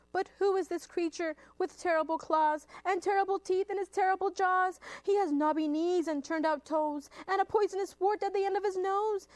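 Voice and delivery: high, hurried storytelling in mounting fright; alarmed. Acted. Mouse describes the terrible creature before him, alarmed. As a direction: breathlessly rattle off the monster's features.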